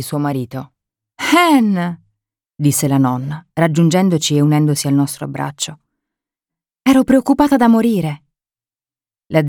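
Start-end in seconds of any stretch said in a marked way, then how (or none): 1.2-2.0 joyful call. Acted. Grandmother greeting returning daughter. 6.8-8.2 relieved outburst. Acted.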